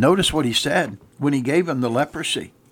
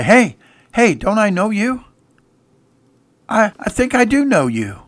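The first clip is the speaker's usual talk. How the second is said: higher, surprised hey; lightly voiced stranger; 9 semitones higher, 4 dB louder than usual. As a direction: greet him with recognition. Stranger recognizing Gehazi.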